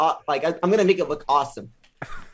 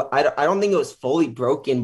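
ordinary delivery

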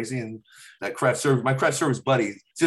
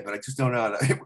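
ordinary talk

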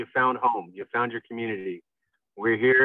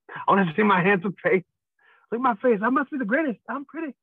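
much higher, preening and self-adoring; vain voice; 10 semitones higher than usual. Pretend. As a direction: play the vain pretty boy. Jokingly vain about own face.

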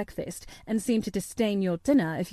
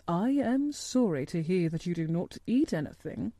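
measured, slightly lowered doctor's voice; grave doctor; 3 semitones lower than usual. Acted. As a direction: state concern formally and soberly. Doctor Craven speaks gravely.

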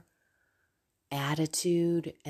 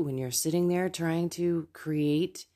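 ordinary talk

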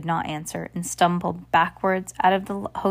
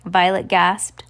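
plain reading voice

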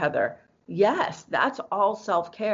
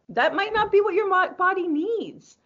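high-pitched emphasis; 12 semitones higher, 3 dB louder than usual. Real, not acted. High squeaky emphasis on body.

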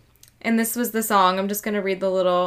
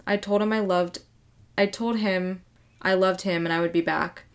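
normal talking voice

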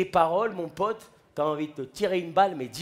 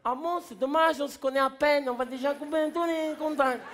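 higher, playful dad voice; dad joking with the cop in a higher voice; cheeky drunk dad; 7 semitones higher than usual. Retold or imitated. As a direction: play the dad as carefree and joking. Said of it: "Mon père, il a dit".

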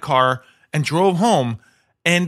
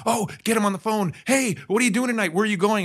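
higher-pitched, keen imitated caller; eager agent; 7 semitones higher than usual. Agents phoning their cool client, eager and higher.

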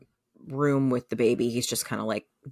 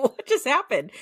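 alarmed; 10 semitones higher than usual. Retold or imitated. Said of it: "And I was like".

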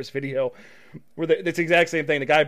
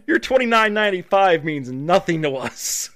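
laughing, higher put-on smug voice; mock company, laughing; 3 semitones higher, 8 dB louder than usual. Host laughing while voicing a rich company, higher and louder. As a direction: laugh through the brush-off.

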